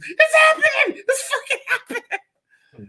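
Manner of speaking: shrieking high with laughter; excited kid. Retold or imitated. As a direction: squeal like a thrilled kid. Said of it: "As a kid who still didn't like horror movies".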